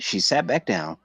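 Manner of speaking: ordinary delivery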